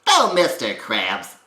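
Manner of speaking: loud, high, very nasal character call; over-the-top nasal cartoon shout. Shown as a demonstration. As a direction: call out up high, nostrily, with a little gravel. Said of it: "up as high as you can possibly get it".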